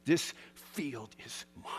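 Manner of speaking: whispered and slow, low and intense; preacher whispering a vow against the storm; defiant whisper. Real, not acted. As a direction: claim it quietly, eye to eye with the storm. Defiant words to the rain.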